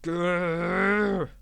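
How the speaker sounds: loud drawn-out growl; roaring growl. Acted. Diesel stuck on the locked points, loud growl. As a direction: growl out in fury. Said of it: "roared Diesel".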